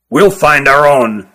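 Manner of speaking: put-on slow old elder voice. Old Kirin Ruggum answers.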